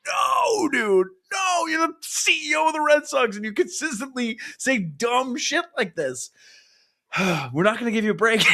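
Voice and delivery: high, exasperated scold. Real, not acted. Fan scolds the Red Sox CEO, high and exasperated. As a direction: tell him off, incredulous.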